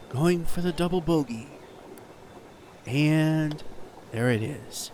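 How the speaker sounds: hushed TV golf announcer voice; golf commentator. Acted. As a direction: call each shot softly like televised golf. Mock golf play-by-play.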